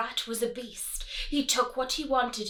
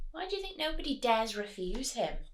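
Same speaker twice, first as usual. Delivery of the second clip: usual speaking voice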